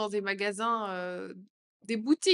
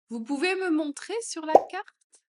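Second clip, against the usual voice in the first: much higher and louder ask; high, loud question; 7 semitones higher, 7 dB louder than usual. Shown as a demonstration. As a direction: lift the whole question up. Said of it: "phrase modelled twice". (plus aigu et plus fort)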